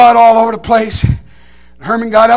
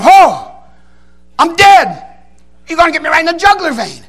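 retold panic; 5 semitones higher, 3 dB louder than usual. Retold panicked thought under bear.

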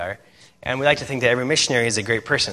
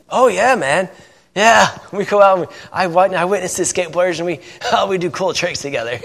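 lightly voiced laid-back surfer dude; a hint of a chill surfer guy, slightly higher; imitating a mellow surfer dude telling about skating; Crush surfer dude; 4 semitones higher, 4 dB louder than usual. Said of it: "he talks like Crush from Nemo".

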